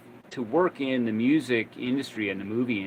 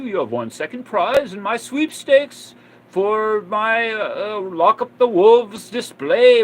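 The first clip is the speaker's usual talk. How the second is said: put-on British accent; 9 semitones higher, 10 dB louder than usual. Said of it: "I think he had a British accent".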